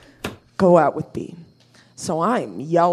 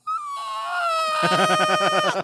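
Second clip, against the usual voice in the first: shocked cry; 5 dB quieter than usual. Retold or imitated. Shocked at being kissed.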